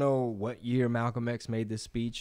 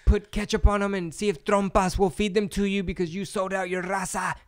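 high, mocking voice of an angry commenter; mocking TikTok commenter; 8 semitones higher, 3 dB louder than usual. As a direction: sneer it out like a troll in the comments. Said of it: "what they told me on TikTok".

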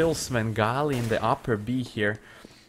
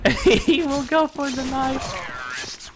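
high, drawn-out cracking up; laughing; 13 semitones higher, 4 dB louder, slower than usual. Real, not acted. Caster calling another knife attempt, laughing hard. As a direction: break into real laughter while calling the play. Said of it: "I'm really sorry for laughing".

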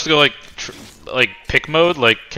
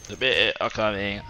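put-on British accent, softer; mock British accent; 3 semitones lower, 7 dB quieter than usual. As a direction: announce it in a fake British accent. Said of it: "we are British".

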